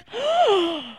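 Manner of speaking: high mock gasp of shock; retold shocked gasp. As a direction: gasp as if scandalised. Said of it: "And I was like".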